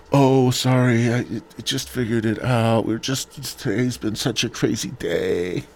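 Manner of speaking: mocking her apologetic text; mock apology. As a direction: draw out the words like a flimsy apology. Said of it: "she texted me that lame ass".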